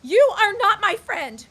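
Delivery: loud, high, tearful shouting; furious shout. Acted. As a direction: order him out, near tears. Diana throws Tom out.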